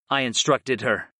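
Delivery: ordinary delivery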